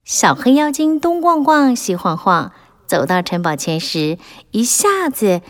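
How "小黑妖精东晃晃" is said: plain reading voice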